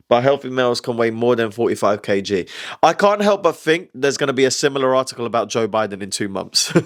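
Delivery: normal talking voice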